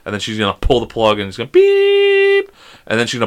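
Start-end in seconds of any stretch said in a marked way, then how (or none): 1.5-2.5 long, very high electronic tone; imitated flatline beep. Dad imagining his daughter pulling the plug, drawn-out beep. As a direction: mimic a monitor going flat.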